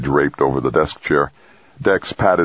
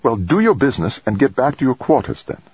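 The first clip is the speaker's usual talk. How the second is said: sharp scolding; 9 semitones higher than usual. A narrator's reading. Trudy scolding Dex.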